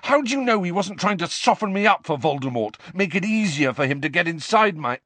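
high-pitched, heated challenge; angry accusation. Acted. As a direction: fire accusing questions at him, pitched high. Harry argues, is cut off.

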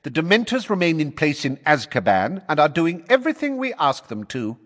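loud, high, hammering retort; forceful. A narrator's reading. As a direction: slam each word down. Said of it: "said Fudge forcefully".

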